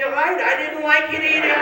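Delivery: very high, loud retort; high loud punchline. Acted. Epstein answering the husband, high and loud.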